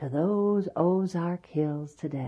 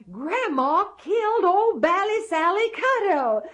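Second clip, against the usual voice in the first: put-on excited boy; 7 dB louder than usual.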